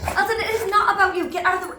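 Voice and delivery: high, loud, amused telling-off; laughing scolding. Real, not acted. Ordering Elton out of Gallop's moment, playfully scolding. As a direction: shoo the dog out loudly through a laugh.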